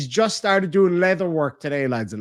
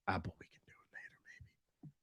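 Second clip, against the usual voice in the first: a breathy secret whisper; whispered aside; 8 semitones lower, 22 dB quieter than usual. Real, not acted. Streamer whispering to chat about the banned sparklers. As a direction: lean in and whisper it, conspiratorial.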